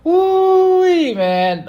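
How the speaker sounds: high, loud drawn-out whoop; whooping with excitement; excited whoop. Real, not acted. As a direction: let out a long, high woo-wee. Reacting to exciting match ending.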